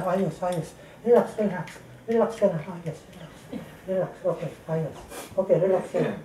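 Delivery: soft, hushed reassurance; calm the frightened patient gently; soothing calm. Real, not acted. Healer calms patient. (mahinahong pagpapakalma)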